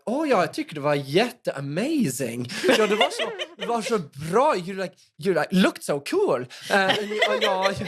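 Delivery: lightly hammed-up Swedish accent mixing in English words; mock Swedish English. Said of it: "do impressions of Swedes in Norway".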